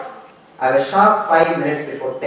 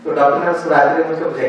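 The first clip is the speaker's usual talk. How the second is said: ordinary talk, nothing marked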